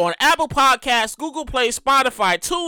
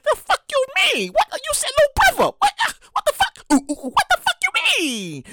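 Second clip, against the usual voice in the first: high, squeaky disbelieving outburst; outraged younger self; 12 semitones higher than usual. Retold or imitated. Brother retelling sister's birth, high mock outrage. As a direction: play his shocked kid self, voice cracking high. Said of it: "And I was like".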